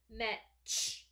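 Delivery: "Metch" with its ch released as a separate burst; short final ch. Shown as a demonstration. Teacher pointing out short air, released ch in "Metch". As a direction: finish "Metch" on a crisp puff of ch. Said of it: "I hear a short air coming out".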